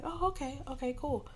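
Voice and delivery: high, sweet receptionist chirp; lightly voiced bubbly office girl; mock-cheerful high-pitched okay. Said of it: "And she's like".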